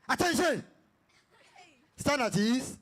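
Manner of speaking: attention snapped high; high sharp trick command. Real, not acted. Game leader tricks the class with a high attention call. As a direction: catch them with a sudden high call.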